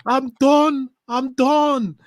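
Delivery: very high, loud wail of giving up; [name]. Acted. Impersonating a fan quitting on his team, high despairing wail. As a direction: cry out like a fan at breaking point. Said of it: "[name] was the best one so far".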